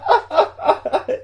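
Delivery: high-pitched real laughing fit; burst of laughter. Real, not acted. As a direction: crack up helplessly. Cracks up at his own muddle. (éclat de rire aigu)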